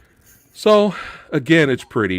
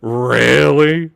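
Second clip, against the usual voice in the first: indignant repeat; 2 semitones lower, 5 dB louder, much slower than usual. Retold or imitated. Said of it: "She was like".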